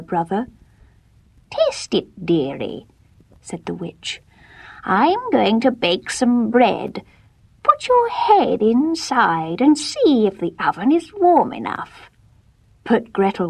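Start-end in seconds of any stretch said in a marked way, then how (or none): 1.5-2.9 witch voice. Acted. Witch tempts Gretel. 4.8-12.1 witch voice. Acted. Witch tricks Gretel.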